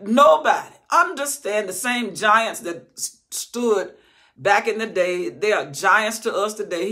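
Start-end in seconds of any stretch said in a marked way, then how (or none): 0.0-4.7 loud high shout of nobody; full-force preaching shout. Real, not acted. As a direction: cry it out. Preaching peak.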